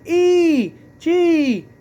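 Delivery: high, slow mock spelling; sing-song mock of the mayor spelling it wrong. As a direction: ham up the mayor fumbling the letters. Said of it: "totally butchered a name".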